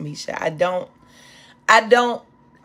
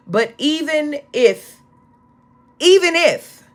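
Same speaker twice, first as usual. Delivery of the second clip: rising preaching cry; 9 semitones higher, 5 dB louder than usual. Real, not acted. Preacher recalling the festival song to the congregation, fervent rising cry.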